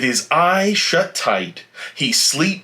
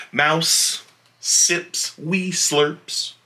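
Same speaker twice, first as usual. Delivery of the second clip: plain reading voice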